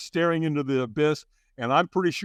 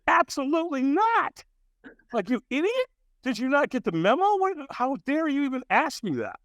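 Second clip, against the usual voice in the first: high-pitched, hammed-up outrage; mock indignation; 12 semitones higher than usual. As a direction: act affronted by an absurd invitation. Acted outraged refusal to stranger.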